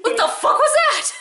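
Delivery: high, laughing stunned Thor. Retold or imitated. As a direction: Thor gaping in disbelief, laughing. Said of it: "it's the look on Thor's face".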